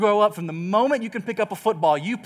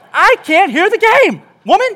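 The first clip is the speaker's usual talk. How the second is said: hammed-up shout, higher and louder; mock yell; 11 semitones higher, 11 dB louder than usual. Husband yelling at his wife over the noise, mock shout. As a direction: bellow it over the racket.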